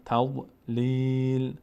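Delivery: تضلیل stretched and stopped at the verse end; pausal form. Shown as a demonstration. Teacher showing how to stop at the verse end. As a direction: close تضلیل with no nunation. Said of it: "اگر آخر آیه بستیم".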